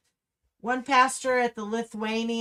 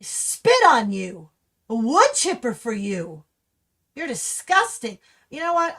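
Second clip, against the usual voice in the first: real anger; 6 dB louder than usual. Real, not acted. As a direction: spit the words out in real rage. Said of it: "I'm getting so mad".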